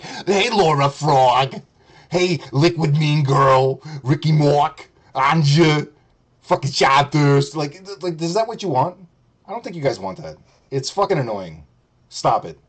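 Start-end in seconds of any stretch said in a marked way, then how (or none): 0.0-7.5 slow, drawn-out greetings to chat; obnoxious calling out. Shown as a demonstration. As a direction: stretch each name like an annoying hype man. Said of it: "I'll be like".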